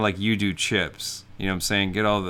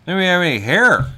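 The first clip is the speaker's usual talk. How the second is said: high, loud, quick heckle from a waiting customer; Norm Macdonald; 11 semitones higher, 12 dB louder, faster than usual. Acted. Customer heckling the bald guy, Norm Macdonald style. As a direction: call it out fast in a high mocking voice. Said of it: "It's like Norm Macdonald there".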